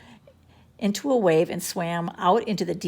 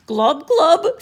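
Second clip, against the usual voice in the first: gurgling glub; 8 semitones higher, 9 dB louder than usual. A narrator's reading. Drowning gurgle.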